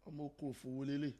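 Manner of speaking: Ondo accent mimic. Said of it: "Oh, so Ondo accent".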